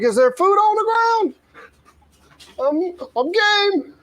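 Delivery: high, eager voiced dog; squeaky hungry dog voice; dog's voice. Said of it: "Mayor was like".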